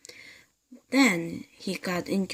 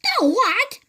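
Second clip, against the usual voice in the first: much higher, loud alarmed shriek; screamed; 6 dB louder than usual. Acted. Mrs Twit shrieking at her husband in bed. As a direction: shriek in disbelief. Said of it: "screamed Missus Twit".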